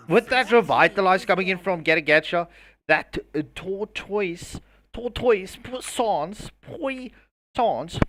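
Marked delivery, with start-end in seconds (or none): 3.5-8.0 bad French accent. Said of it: "my French accent just sucks".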